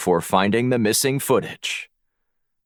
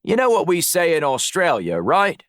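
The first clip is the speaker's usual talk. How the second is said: much higher, matey Aussie voice; Australian accent; 8 semitones higher than usual. A narrator's reading. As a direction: lay on a put-on Australian accent. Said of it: "summoned his best Australian accent".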